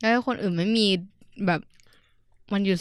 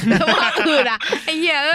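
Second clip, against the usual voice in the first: higher, louder, laughing; laughing tease; 8 semitones higher, 6 dB louder than usual. Real, not acted. Mocking a friend's answer, laughing louder. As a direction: crack up while teasing.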